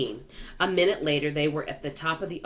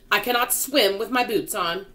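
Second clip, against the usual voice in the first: raised, loud complaint; urgent cry; 6 semitones higher, 6 dB louder than usual. A narrator's reading. Centipede frets about swimming in boots, urgent cry. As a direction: protest with some urgency. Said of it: "cried the centipede".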